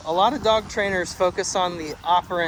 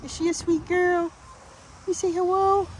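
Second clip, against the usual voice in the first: much higher, sing-song cooing. Real, not acted. Cooing to dog.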